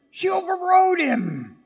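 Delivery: high, loud shouted point. Real, not acted. Preacher shouts the climax to his congregation. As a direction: shout it out to the church.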